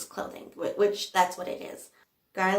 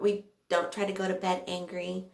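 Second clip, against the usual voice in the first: ordinary talk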